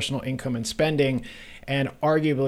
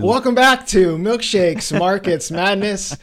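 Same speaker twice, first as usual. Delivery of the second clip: announcer intro; 6 semitones higher, 5 dB louder, slower than usual. Acted. Hammed-up show opening.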